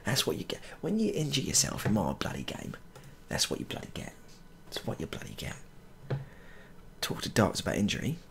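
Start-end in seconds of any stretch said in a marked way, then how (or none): none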